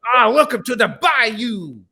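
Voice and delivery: high, slow Cajun voice; Cajun accent. Retold or imitated. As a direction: welcome them in a hammy Cajun accent. Joins the Gambit Cajun bit.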